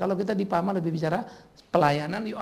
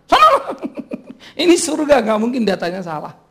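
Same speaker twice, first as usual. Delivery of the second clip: very high, loud laughing yell; shouted dismissal; 6 semitones higher, 9 dB louder than usual. Acted. Angel shooing the protester off, laughing shout. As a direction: bark it away with a laugh. Angel sending sinner away.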